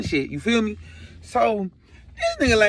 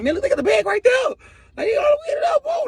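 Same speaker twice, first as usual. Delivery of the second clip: high squealing yell, acted; frantically steer them to the bag; shrieky hammed-up alarm; urgent pointing-out; 4 dB louder than usual.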